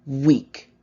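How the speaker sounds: loud curt sneer; scornful jab. Acted. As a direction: throw the word like a slap. Mirror voice spits an insult.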